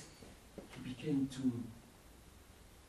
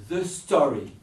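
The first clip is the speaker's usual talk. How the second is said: usual speaking voice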